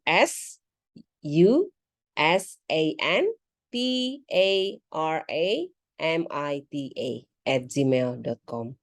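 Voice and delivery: slow, letter by letter spelling. Acted. Student spelling her email address for the interviewer, slow and deliberate. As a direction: spell each letter out slowly and clearly.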